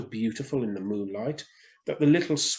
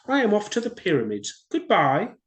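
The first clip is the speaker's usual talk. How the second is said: higher, louder bird voice for the swallow; swallow's voice; 9 semitones higher, 6 dB louder than usual. Acted. Swallow explaining to the reed, higher and louder. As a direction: voice the swallow up high.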